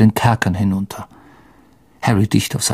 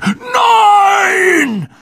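desperate shout. Acted. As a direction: scream it in despair. Shouting as creature escapes.

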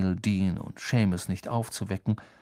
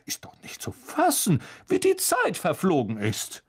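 high, louder, theatrical voice; Lockhart; 7 semitones higher, 3 dB louder than usual. Acted. Lockhart marvelling at the late hour, high and hammy. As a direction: exclaim like a showman surprised by the clock.

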